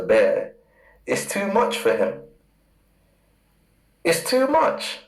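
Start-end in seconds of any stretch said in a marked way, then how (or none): 4.0-5.0 leaps up high, rising like a pained cry; exclaiming. Real, not acted. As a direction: throw the line up high in mock anguish. Preacher stresses Esau's judgment.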